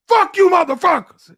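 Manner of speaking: high, loud drunken-heckler imitation; acted taunting shout. Drunk sailor driving by the gate guards, acted taunting shout. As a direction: holler it at guards from a passing car.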